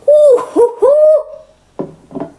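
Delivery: very high fake laugh into hoots; forced high laugh. Laughing off her grim life, high and forced. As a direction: laugh and hoot in a high falsetto.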